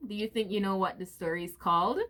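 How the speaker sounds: plain reading voice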